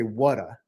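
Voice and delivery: "Whata" as a regional "water"; low, accented "Whata" for water; Philly pronunciation. Shown as a demonstration. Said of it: "They say".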